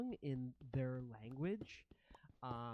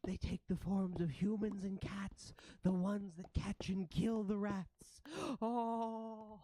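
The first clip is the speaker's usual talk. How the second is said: high, louder wailing rat voice; horrified ratling; 6 semitones higher, 3 dB louder than usual. Acted. Ratlings seeing forms of humans and cats, high wailing dismay. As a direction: lament in acted horror.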